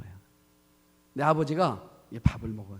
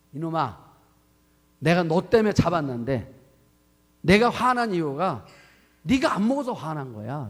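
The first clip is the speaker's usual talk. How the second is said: louder, stern scolding of a son; father scolding; 3 semitones higher, 7 dB louder than usual. Retold or imitated. Father scolds son for skipping the raw fish, loud stern scolding. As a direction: tell the boy off for ignoring your fish.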